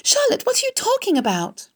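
very high, urgent pitch; alarmed cry. A narrator's reading. Wilbur questions his dying friend, alarmed cry. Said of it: "he cried".

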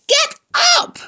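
piercing high, loud order; scream. A narrator's reading. Wilbur orders the lazy rat up, exasperated scream. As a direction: scream it at him. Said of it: "screamed Wilbur".

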